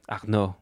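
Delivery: "Arnaud" with a throaty French r and silent d; French pronunciation. Shown as a demonstration. Co-host tries the name as French for Arnold, French pronunciation. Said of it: "it's the French way of saying Arnold".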